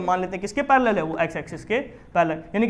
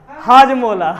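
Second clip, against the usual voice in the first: laughing at own joke; 3 semitones higher, 6 dB louder, much slower than usual. Real, not acted.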